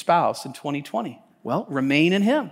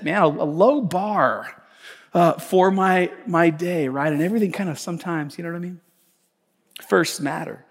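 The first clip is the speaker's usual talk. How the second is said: ordinary talk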